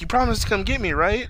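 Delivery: high childlike retold plea; imitated needy youngster; pleading little creature.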